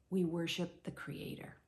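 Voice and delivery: hushed worship line; reverent whisper. Real, not acted. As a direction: breathe it out in awe. Sermon point, reverent.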